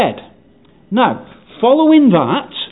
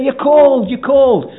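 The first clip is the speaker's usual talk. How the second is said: put-on higher-pitched children's game call; calling out hints; 6 semitones higher, slower than usual.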